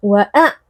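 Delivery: "wa'a" short, the final sound abruptly stopped; wrongly cut ain. Shown as a demonstration. Teacher repeats the mistake, "wa'a" cut off short. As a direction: break off "wa'a" sharply at the throat. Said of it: "tidak boleh suaranya langsung diputuskan".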